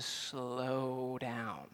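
slow right down, stretching SLOW out softly; drawn-out slowing. Shown as a demonstration. Urges slow reading, enacts slowing down.

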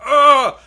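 loud, high retching ugh of disgust. Retold or imitated. Gulps tea by mistake and retches in loud disgust. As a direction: spit it out like a mouthful of bad tea. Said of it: "literally was just like".

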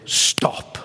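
a sudden whispered command. Shown as a demonstration. Preacher to congregation, whispered stop. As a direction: drop to a bare whisper to stop them. Preacher hushes the word still.

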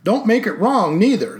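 put-on old Texas sheriff. A narrator's reading. Sheriff retorts.

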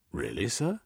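a touch of surprise, lifted a little; mildly taken aback; surprised. A narrator's reading. Sergeant hearing the gentleman's offer, mildly surprised. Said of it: "The sergeant sounded surprised".

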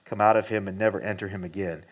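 quiet, measured Bible reading; scripture reading. Acted. Pastor reading Mark to the congregation, hushed and steady. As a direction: read the passage low and steady. Reads scripture passage aloud.

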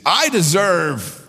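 high, drawn-out entitled whine; mock entitlement. Preacher mimics people claiming what they deserve, mock entitled. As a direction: stretch it out high in mockery. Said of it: "favorite things to hear people say".

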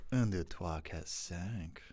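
soft, low, romantic murmur; hushed romantic French. Shown as a demonstration. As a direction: count softly like a tender seduction, trailing off. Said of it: "you gotta do it like this".

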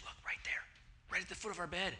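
breathless whisper, pointing something out; hushed whisper. Retold or imitated. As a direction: whisper it so nothing hears you. Wife seeing something at night.

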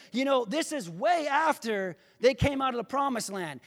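usual speaking voice

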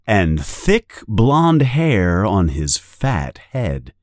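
plain reading voice

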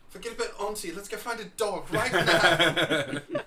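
high loud laughing shout; excited yell. Real, not acted. Excited yell about finding dog.

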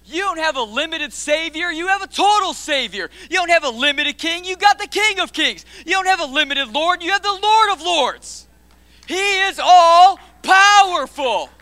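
loud, high preacher's cry; preaching at full force. Acted. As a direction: proclaim it to the whole room.

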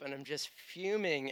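ordinary talk, nothing marked

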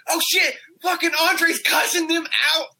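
high, excited play-by-play exclamation; excited onlooker. Retold or imitated. Imitating classmates watching the dealer's outburst, excited. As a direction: imitate an amazed bystander spreading the news. Onlooker's amazed reaction, acted out.

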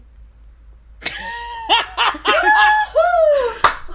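high, loud shrieking laughter. Real, not acted. Players reacting to the twist with shrieking laughter. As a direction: burst out screaming with laughter.

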